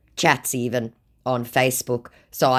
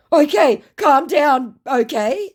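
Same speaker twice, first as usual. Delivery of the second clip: high, loud put-on pleading; mock placating scammer; 8 dB louder, slower than usual. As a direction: placate loudly in a raised pitch. Acts scammer placating her.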